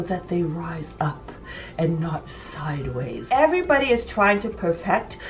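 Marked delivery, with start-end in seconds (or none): 0.0-3.3 whispered tip, voice gone to breath. Acted. Sharing a baking secret with viewers, she whispers. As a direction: let the words drop to a secret whisper.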